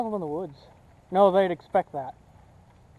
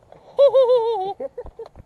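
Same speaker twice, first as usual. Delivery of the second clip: high villain cackle; evil cackle; 14 semitones higher, 4 dB louder than usual. Pretend. Laughing about evil plan.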